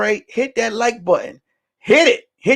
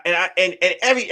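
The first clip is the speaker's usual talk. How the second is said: usual speaking voice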